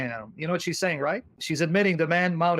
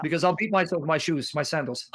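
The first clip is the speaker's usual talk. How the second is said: usual speaking voice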